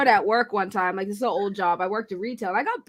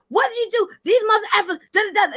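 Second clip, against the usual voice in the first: much higher, angry mom scolding; mother cussing out; 9 semitones higher, 3 dB louder, much faster than usual. Retold or imitated. Mother cusses him out over school trouble, high and angry. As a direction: rant like a furious mom.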